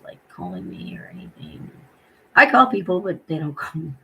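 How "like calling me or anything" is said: whisper. Real, not acted. Lonely, nobody calls her.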